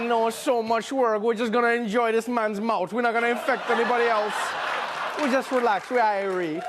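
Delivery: chilled Jamaican patois voice; Jamaican accent. Retold or imitated. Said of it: "Jamaican diseases are chilled out".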